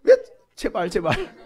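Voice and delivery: stammered protest, starting high; mock begging with laughter in it; whiny pleading. Reluctant one begs to be spared, whining. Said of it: "우리 수진이가 맨날 그래".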